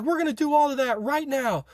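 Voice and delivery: high, insistent imitation of an order; mock commanding instructor. Instructors ordering push-ups for the squadron, mock commanding.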